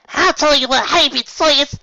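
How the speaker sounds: high, loud accusing voice; hostile Apple Bloom. Acted. Apple Bloom confronting Applejack, high loud voice. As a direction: stare her down. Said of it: "said Apple Bloom".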